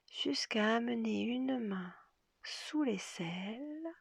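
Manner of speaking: ordinary talk, nothing marked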